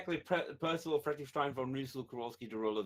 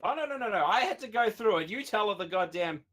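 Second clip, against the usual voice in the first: excited protest; 6 semitones higher, 4 dB louder than usual. Real, not acted. Protesting the shortened name.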